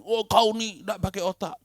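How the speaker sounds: high, mocking scolding voice. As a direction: mimic an exasperated boss. Boss scolding quoted in sermon. (suara bos memarahi dengan nada tinggi)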